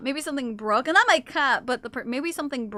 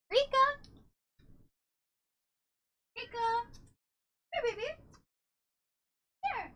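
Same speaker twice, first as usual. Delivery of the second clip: cat call; 11 semitones higher, 10 dB quieter than usual. Real, not acted. Calling cat from afar, fading.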